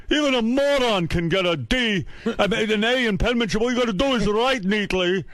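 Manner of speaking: high-pitched mock dad rant; imitated yelling father. Father confronts son over report card, mock yelling. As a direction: scold like an exasperated dad, voice pushed high. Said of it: "he just fucking yelled at me".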